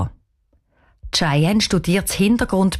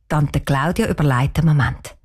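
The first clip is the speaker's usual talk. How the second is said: ordinary delivery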